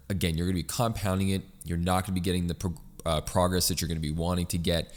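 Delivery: ordinary talk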